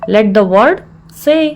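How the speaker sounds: measured, with a clear mid-phrase pause; broken into parts. Shown as a demonstration. Teacher repeats the phrase for learners, in separated parts.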